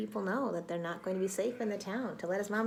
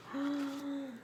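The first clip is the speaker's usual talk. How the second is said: gasp; 6 semitones higher, 4 dB quieter than usual. Acted. Reader reacts to the news.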